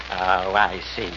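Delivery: low, slow dawning; slow deflated realization. Acted. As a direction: let the penny drop slowly. Realizes it's a trick.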